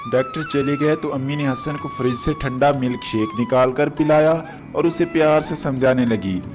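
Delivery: ordinary delivery, nothing marked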